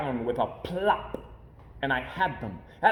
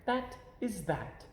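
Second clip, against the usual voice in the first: slow, softer, final; quietly pleased finish; satisfied Cat; 4 semitones higher, 8 dB quieter than usual. A narrator's reading. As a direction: close the matter calmly. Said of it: "Then he said".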